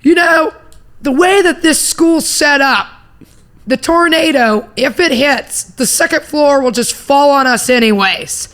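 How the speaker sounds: high, loud, slowly stretched announcement; teacher's announcement. Retold or imitated. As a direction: imitate a teacher addressing a packed hallway, drawing it out. Teacher addressing silent students in storm.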